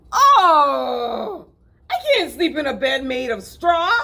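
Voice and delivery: high, loud complaining groan. Acted. City Mouse groaning at the straw bed, loud and high. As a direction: moan loudly at the straw bed. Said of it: "he groaned loudly".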